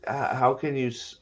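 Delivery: usual speaking voice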